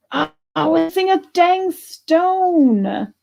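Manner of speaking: high, slow disappointed whine; dismayed whine. Real, not acted. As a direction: let the letdown pull it up and out. Finds a missing stone.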